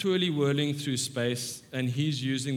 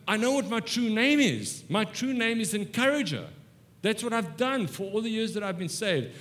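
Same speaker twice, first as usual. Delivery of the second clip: triumphant declaration; 7 semitones higher than usual. Retold or imitated. Retells woman's triumphant declaration of name.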